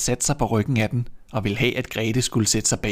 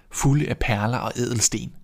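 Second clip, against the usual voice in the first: ordinary delivery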